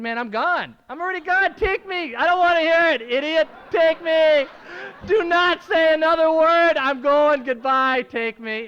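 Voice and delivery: loud, fed-up shouting, some words dragged out slowly; yelling at the judge in his head to get it over with; exasperated inner yell. Retold or imitated.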